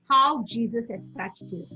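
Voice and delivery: ordinary talk, nothing marked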